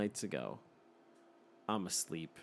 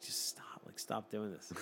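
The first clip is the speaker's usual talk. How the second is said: pleading hush; 9 dB quieter than usual. Retold or imitated. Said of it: "I'm like".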